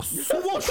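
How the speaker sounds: high mouthed swish; sudden airy sound effect; whoosh of belt. Retold or imitated. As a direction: imitate a belt whipped out like a sword. Imitates belt being whipped out.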